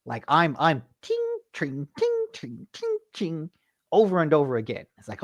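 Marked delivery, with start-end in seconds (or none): 0.6-3.5 high, ringing ting sounds; sword clang noises. Retold or imitated. Gamer imitating parries while describing combat, ringing tings. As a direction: voice the blades clashing over and over.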